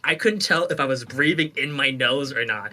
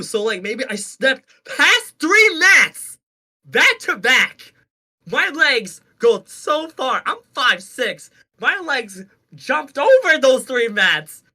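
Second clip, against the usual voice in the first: high, loud, drawn-out ranting; hammed-up outraged sarcasm; mock incredulous rant; 9 semitones higher, 5 dB louder, slower than usual. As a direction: spell out the absurdity, exasperated. Sarcastic defence against brother's doubt.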